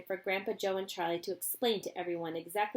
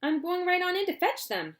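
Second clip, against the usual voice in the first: high cheery eccentric; gleefully daring; Mister Wonka; 11 semitones higher, 6 dB louder than usual. Acted. Wonka announces his plan, cheery.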